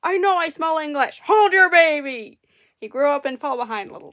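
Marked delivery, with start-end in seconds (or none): 0.1-2.4 furious giant shouting; giant yelling. A narrator's reading. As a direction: roar after the thief. Giant chasing thief.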